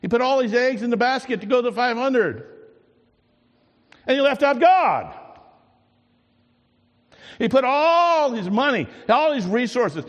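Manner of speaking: loud, high preaching at full force; rising pulpit fervour; fervent preaching. Acted. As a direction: preach it out with fire to the congregation.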